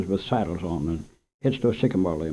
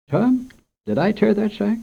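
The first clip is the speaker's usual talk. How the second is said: surprised; 11 semitones higher than usual. Acted. Storekeeper startled by spill.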